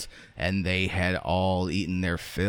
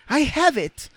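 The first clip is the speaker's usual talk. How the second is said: loud, very high excited squeak; Raggedy Ann's voice; 6 dB louder than usual. A narrator's reading. Rag doll jumping up with an idea, squeaky. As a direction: burst out in a piping falsetto.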